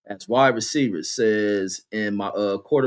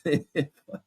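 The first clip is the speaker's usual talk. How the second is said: ordinary talk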